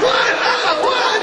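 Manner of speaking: high, startled yelps of confusion. Retold or imitated. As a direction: jump in surprise, voice shooting way up. Startled by man at con.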